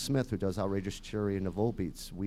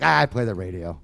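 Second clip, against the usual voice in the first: imitating dad; 8 dB louder than usual. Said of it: "My dad's like".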